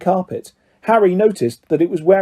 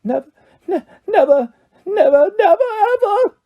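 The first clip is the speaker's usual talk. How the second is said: high, wailing sobs rising ever higher; sobbing elf. A narrator's reading. House elf bursting into noisy tears, sobbing. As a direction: cry it out, each never climbing. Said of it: "the elf burst into tears".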